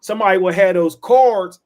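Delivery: normal talking voice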